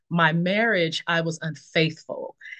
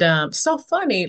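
usual speaking voice

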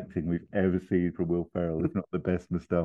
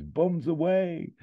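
high, drawn-out jump cry as Austin; exclaiming; 12 semitones higher, slower than usual. Acted. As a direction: stretch it out high like leaping from a plane. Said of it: "and exclaims".

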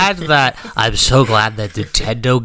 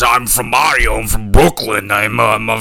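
put-on Brooklyn accent; 2 semitones lower than usual. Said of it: "a Boston accent".

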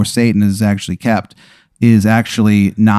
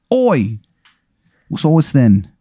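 bad English accent; 5 semitones higher, slower than usual. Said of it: "His accent is fucking terrible".

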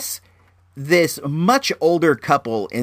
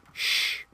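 soft, voiceless shushing hiss; 10 dB quieter than usual. Retold or imitated. Moviegoer to noisy couple in the theater, soft shush. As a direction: quietly shush the people nearby. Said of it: "give them the shush".

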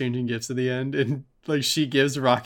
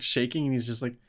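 ordinary talk

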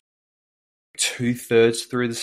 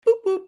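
high squeaky boop boop; boop noise; 6 dB louder than usual. Retold or imitated. Mimicking a machine beeping, high squeaky boops. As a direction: chirp it like a button beep. Imitating button press noise.

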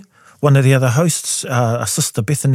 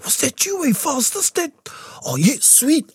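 high, hushed mock amazement; amazed questioning; 4 dB quieter than usual. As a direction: act out wide-eyed disbelief, pitched high. Acts out talking to unseen helper.

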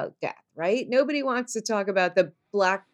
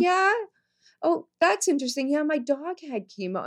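higher, quicker mock-interested voice; mock bright reply; 7 semitones higher than usual. Mocking the friend who brought up her dog. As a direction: play along with fake interest. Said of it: "I'm like".

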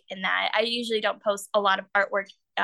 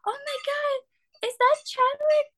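imitated amazement. Artist retells costar spotting her drawing, high-pitched amazement. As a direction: play his astonished reaction, pitched way up.

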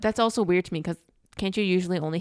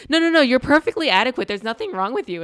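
loud, higher-pitched mock reassurance; hurried reassurance; 6 semitones higher, 8 dB louder than usual. Therapist on the phone with her client, mock frantic reassurance. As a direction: insistently soothe a client, hammed up.

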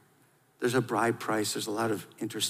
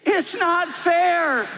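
much higher, slow, stretched whine; high, drawn-out childish whining; whiny voice; 7 dB louder, much slower than usual. Shown as a demonstration. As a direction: lead the crowd in a big sulky whine. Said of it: "Say it with me in a whiny voice".

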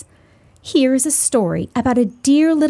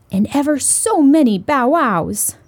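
ordinary delivery, nothing marked

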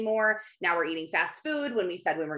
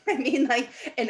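usual speaking voice

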